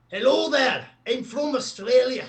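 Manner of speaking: loud, high-pitched, way-off Australian accent; botched Aussie accent. Shown as a demonstration. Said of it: "Not quite, not quite".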